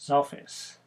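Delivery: normal talking voice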